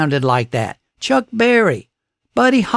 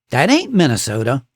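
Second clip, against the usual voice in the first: ordinary talk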